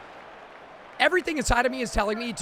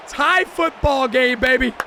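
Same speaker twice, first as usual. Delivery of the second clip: higher, louder, slow celebration; hyped; 3 semitones higher, 6 dB louder, slower than usual. Real, not acted. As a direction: savor it like a hype man. Hyped at halftime tie.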